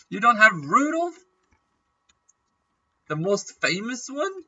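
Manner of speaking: high, loud disbelief; incredulous. Real, not acted. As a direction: ask in outraged surprise. Complaining game lacks Rudolph.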